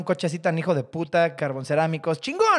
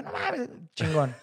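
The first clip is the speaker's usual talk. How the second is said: stunned awe; 2 semitones lower, 7 dB quieter, slower than usual. Retold or imitated.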